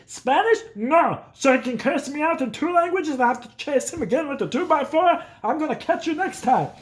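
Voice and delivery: high, ranting caricature of a father; imitated dad. Dad complaining about his child's English, mock exasperated. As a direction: rant as an exasperated old-school dad. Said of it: "He's like".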